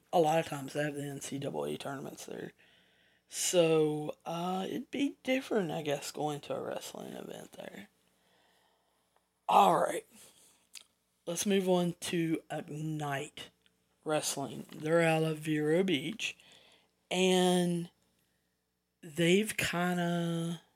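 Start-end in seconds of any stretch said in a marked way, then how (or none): none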